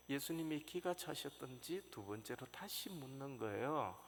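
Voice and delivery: normal talking voice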